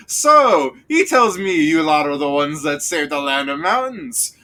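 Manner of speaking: loud, high opening then gruff guard questioning. Acted. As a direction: boom out the So then settle into suspicion. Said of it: "and Munchop goes".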